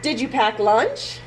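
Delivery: ordinary delivery, nothing marked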